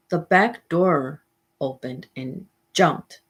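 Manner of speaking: plain reading voice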